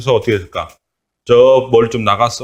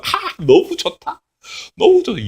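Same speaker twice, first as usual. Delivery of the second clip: much higher, slow delighted sigh; 14 semitones higher than usual. Acted. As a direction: savor the shade with pleasure. Jonah enjoys the shade.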